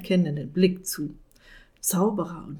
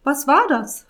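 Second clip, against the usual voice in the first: suspicious witch; 8 semitones higher, 7 dB louder than usual. Acted. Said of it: "fragt sie argwöhnisch".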